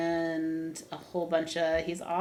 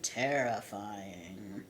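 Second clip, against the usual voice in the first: very low, slow and soft; hammed-up menacing drop in pitch; ominous blurb reading; 10 semitones lower, 11 dB quieter, much slower than usual. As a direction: draw it out like a horror trailer. Dramatic reading of book blurb.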